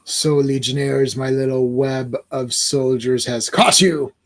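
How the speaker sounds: deep, slow gloating villain; low slow villain. Acted. Villain gloating over captured Legionnaires, low and slow. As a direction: savor the trap like a villain. Villain voiced reading comic.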